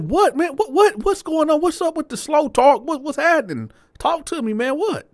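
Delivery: high, loud incredulous pleading; squeaky stunned what's-going-on voice; shocked disbelief. Retold or imitated. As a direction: demand answers in high-pitched shock. Shocked retold reaction to news.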